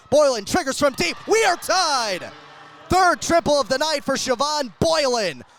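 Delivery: excited call. Real, not acted. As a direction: celebrate the tying shot out loud. Game-tying three called live.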